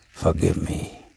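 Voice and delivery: low, soft and feeble; a wounded man barely getting the words out; faint plea for forgiveness; weak voice. A narrator's reading. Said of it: "said the bearded man in a weak voice".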